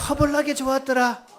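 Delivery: high, rolling Jeolla call; Jeolla dialect. Shown as a demonstration. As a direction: lead the crowd in playful Jeolla dialect. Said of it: "전라도 버전 네 가지나 있습니다". (높게 띄운 전라도 사투리)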